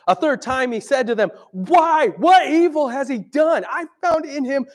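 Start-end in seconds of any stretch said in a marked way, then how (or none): 1.5-4.6 high, loud exasperated plea; pleading Pilate. Retold or imitated. Pilate pleading with the shouting crowd, loud and high. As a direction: argue desperately with the crowd.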